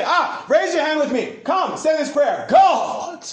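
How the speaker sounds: high, hammed-up preacher calling people to pray; dramatic false teacher. Preacher mocking false teachers to his congregation, overdramatic. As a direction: parody an altar call, building to a loud cry. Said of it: "They're all dramatic".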